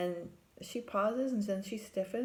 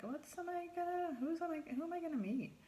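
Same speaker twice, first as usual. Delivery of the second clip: high, fast flustered blurt; replaying her own anxious thoughts in a high rush; panicky inner voice; 10 semitones higher, faster than usual. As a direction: rush it out in a nervous squeak. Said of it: "and I'm like".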